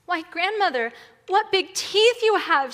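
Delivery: put-on high, loud storybook girl; rising wide-eyed wonder, pitched high; alarmed little girl. A narrator's reading. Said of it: "she said".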